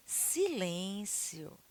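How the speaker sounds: soft, drawn-out hush; quiet, lingering plea for silence; gentle request. Shown as a demonstration. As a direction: ask for silence softly and slowly. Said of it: "A emoção que eu vou colocar".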